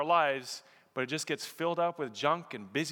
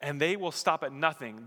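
ordinary delivery

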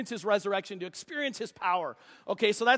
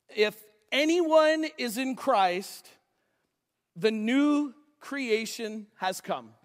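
slow, deliberate reading of the verse; slow scripture reading; much slower than usual. Shown as a demonstration. Pastor reading a verse to the congregation, slow and deliberate. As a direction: read it slowly so every word lands. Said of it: "I want you to listen to what Paul says".

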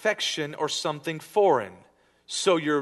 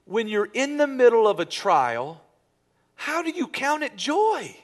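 impassioned preaching; 8 semitones higher, 5 dB louder than usual. Real, not acted. Preaching builds.

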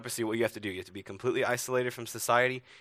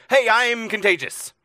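loud, high public warning; yelling; 9 semitones higher, 8 dB louder than usual. Acted. Sick person warning anyone nearby of infection, yelling. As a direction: shout it out for everyone around to hear. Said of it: "you had to yell".

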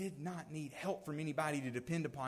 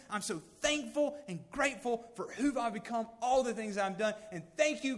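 higher, rushing, self-congratulating prayer; a proud man thanking God for himself; smug self-praise; 6 semitones higher, 4 dB louder than usual. Pretend. Mocking self-satisfied Israelite prayer.

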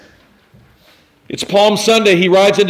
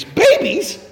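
mock astonishment. Preacher mock bafflement.

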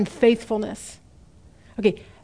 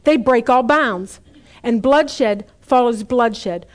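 scripture reading; 4 dB louder than usual. Acted. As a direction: proclaim the verses like a preacher. Reads scripture aloud from Hosea.

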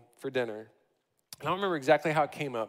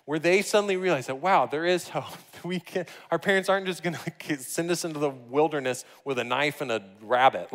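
ordinary talk

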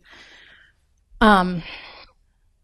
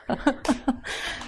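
soft unvoiced laugh; a quiet laugh at herself; light breathless chuckle; breathy laugh; 4 semitones higher, 8 dB quieter than usual. Real, not acted. Laughing at her own story.

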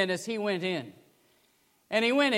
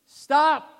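sharp, loud, higher shout; yelled stop; 8 semitones higher, 8 dB louder than usual. Retold or imitated. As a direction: cut the auction off with a yell. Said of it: "the Englishman yelled".

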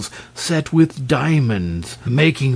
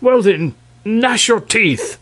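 angry king; 10 semitones higher, 3 dB louder than usual. Acted. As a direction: order it in a temper. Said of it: "the king".

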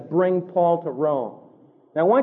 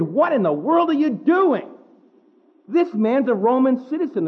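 alarmed outburst; 6 semitones higher than usual. Retold or imitated. Centurion alarmed to his commander, high startled outcry. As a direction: blurt it out in shock.

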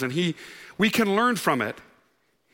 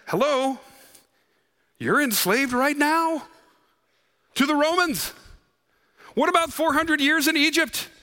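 higher, mocking sarcastic retort; 7 semitones higher than usual. Preacher imagining Jesus mocking the crowd, sarcastic. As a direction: needle them with each question. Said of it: "He's not nearly as sarcastic".

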